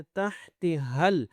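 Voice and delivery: تحت with haa from the upper throat; slow articulation demo of تحت حل. Shown as a demonstration. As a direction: chant تحت حل slowly, syllable by syllable. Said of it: "گلے کے اوپر والی سے آواز".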